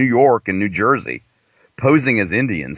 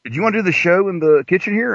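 cousin asking; 7 semitones higher, faster than usual. Retold or imitated. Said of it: "my cousin was like".

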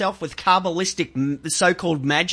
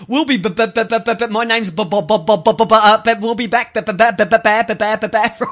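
rapid mock bebop stutter; stammering host; 4 dB louder, faster than usual. Co-host imitates a host going to break, mock stammer. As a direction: stutter in rhythmic bursts, lightly mimicking. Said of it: "It's like, like".

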